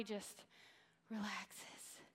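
soft breathy release on the word; whispered. Acted. To the congregation, a whispered relaxes. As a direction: let the word sink to a whisper. Body relaxing on solid ground.

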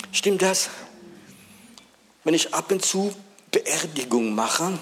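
loud, high, driving altar call; preaching at full force. Acted. Preacher calling the congregation to Jesus, loud and high.

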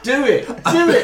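high, loud laughing chant; egging on. Real, not acted. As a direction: goad a shy friend into performing. Egging a shy player on.

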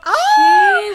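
a sudden high, loud "Oh!"; overjoyed yelp at good news; surprised shriek. Retold or imitated. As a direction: squeal of delighted surprise. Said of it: "And I was like".

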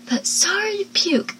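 high-pitched mock disgust; imitated contemptuous scoff. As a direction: scoff it, pitch leaping on sorry. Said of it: "Abby then scoffs and says".

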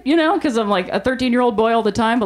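ordinary talk

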